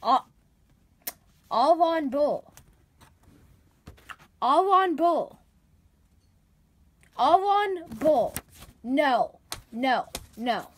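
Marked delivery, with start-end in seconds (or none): 1.5-8.3 "Alvon Bull" sounded out slowly, a little louder; reading the misspelling. Shown as a demonstration. Teacher sounding out a misspelled name from a report. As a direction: puzzle over "Alvon Bull" as written. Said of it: "Died from loss the duel with".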